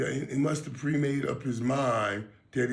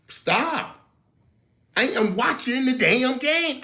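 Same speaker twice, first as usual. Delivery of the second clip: exasperated; 11 semitones higher, 6 dB louder, slower than usual. Retold or imitated. Mock exasperation at critics.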